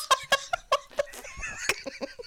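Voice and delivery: squealing burst of laughter; high shrieking laugh. Real, not acted. Shocked at story.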